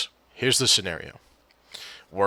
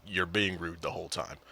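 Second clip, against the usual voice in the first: ordinary talk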